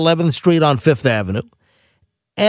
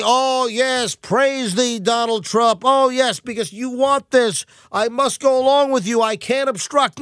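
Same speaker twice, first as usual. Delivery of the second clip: mock fawning; 6 semitones higher than usual. Sarcastic mock fawning over Trump.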